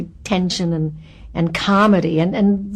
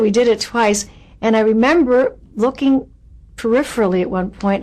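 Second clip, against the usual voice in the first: ordinary talk, nothing marked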